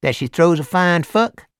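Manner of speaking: big Southern biker voice; Deep South accent. A narrator's reading. Hell's Angel asking Morris, loud Deep South accent. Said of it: "then brightened".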